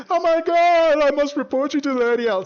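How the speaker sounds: high, loud shrieked alarm; mock outrage. Mocking the reaction Leon never had, shrieked high and loud. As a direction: shriek it like a hysterical tattletale. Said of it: "But he didn't go".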